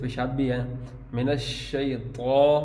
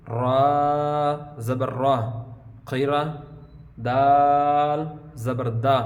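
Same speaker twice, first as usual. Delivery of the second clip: slow, drawn-out syllable-by-syllable spelling; slow spelling drill; much slower than usual. Shown as a demonstration. Teacher spells out the Qaida lesson for students, slow and drawn out. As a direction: sound out each letter clearly for the pupils.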